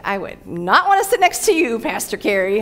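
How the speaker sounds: joking impression of her husband; teasing husband. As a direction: play Pete cringing at the idea. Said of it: "Pete's like".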